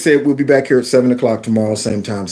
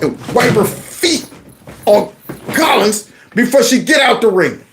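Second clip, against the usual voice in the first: raised, excited ringside commentary over a knockout; wrestling play-by-play; 10 semitones higher than usual. Pretend. Host calling the fight like a ringside announcer, excited. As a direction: call it like a wrestling announcer, pitched up high. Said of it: "I gotta narrate this one".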